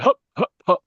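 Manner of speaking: high, loud showy hup hup hup; circus hups. Retold or imitated. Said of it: "he can't stop doing this bloody hup".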